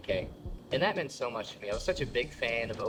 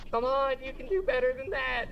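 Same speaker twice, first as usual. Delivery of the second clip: high, louder coaxing; crowd hype; 12 semitones higher, 4 dB louder than usual. Retold or imitated. Host urges a silent crew, pumped. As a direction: coax the crowd up.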